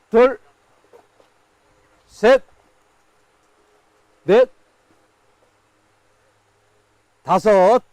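loud countdown calls; auctioneer. Real, not acted. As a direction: call out each count to the room. Auction countdown called to bidders.

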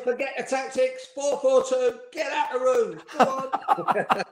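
high, strained mock shouting; high-pitched retold rant, breaking into laughter at the end; exasperated manager. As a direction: act out a manager giving up on the team talk. Imitates exasperated manager clearing the room.

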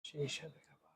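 soft breathy whisper of delight. Real, not acted. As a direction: whisper it like a secret treat. Savoring food mid-meal. (ফিসফিস করে)

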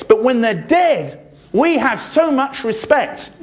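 high, slow mocking sarcasm; bitter sarcasm. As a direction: drag it out scornfully. Bitter sarcasm about respect.

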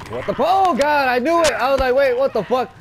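high, loud freaked-out shouting; scared shriek after a jump scare; startled panic yell. Real, not acted. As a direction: yelp it out in real panic. Jump scare in horror game.

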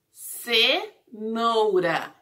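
"Cenoura" very slow, pause after "Ce"; syllable by syllable. Shown as a demonstration. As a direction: stretch both halves of "Cenoura" with a gap between. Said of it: "a sílaba do C".

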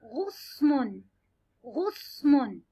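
غصن stretched slowly; slow sounding out. Shown as a demonstration. Teacher to children, drawing out غصن slowly. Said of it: "نختار حرف الغين مع ضمة".

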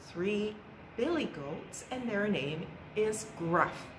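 usual speaking voice

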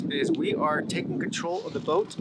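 ordinary talk

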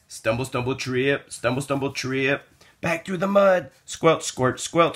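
ordinary delivery, nothing marked

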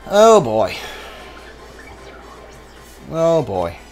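usual speaking voice

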